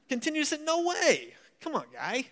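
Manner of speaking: put-on Yankee accent. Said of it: "my Yankee accent went out the door".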